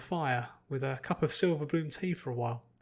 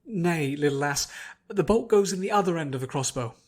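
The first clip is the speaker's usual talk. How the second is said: louder, firm teacher correcting; gruff paladin; 2 semitones higher, 7 dB louder than usual. Acted. Paladin correcting Fluttershy's crossbow, louder and firm. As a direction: boom a kindly correction.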